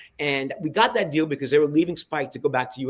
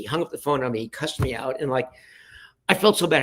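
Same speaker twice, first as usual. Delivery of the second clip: ordinary talk, nothing marked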